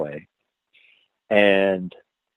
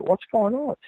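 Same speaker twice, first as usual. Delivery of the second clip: innocent; 11 semitones higher, 5 dB quieter than usual. Retold or imitated. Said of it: "very innocent".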